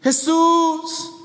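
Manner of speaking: high, loud calling out; shouting the name. Retold or imitated. Beggar shouting after Jesus leaving town, shouting the name. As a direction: cry out across the road. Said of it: "este hombre seguía gritando".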